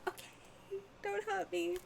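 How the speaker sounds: high, breathless, frightened squeak; timid whimper. Retold or imitated. Small kid pleading with an aggressive girl, high frightened whisper. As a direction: shrink away and plead. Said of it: "She scared me".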